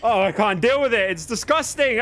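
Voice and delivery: high, loud venting; exasperated outburst. Real, not acted. Streamer fed up with frame rate, high exasperated outburst. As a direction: wail the complaint in frustration.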